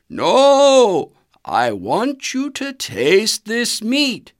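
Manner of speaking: father tiger insisting the cub taste the meat; insistent father tiger. A narrator's reading. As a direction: insist firmly he eats. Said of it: "insisted the father tiger".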